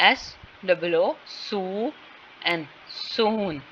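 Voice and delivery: very slow, each sound of सू and एन drawn out before soon; slow sound-by-sound spelling. Shown as a demonstration. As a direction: spell out soon slowly, letter by letter, teacher-style. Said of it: "जैसे ये word है soon".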